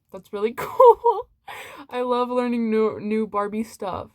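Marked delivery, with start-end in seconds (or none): 0.1-1.3 laughing delight. Real, not acted. Delighted by new Barbie trivia.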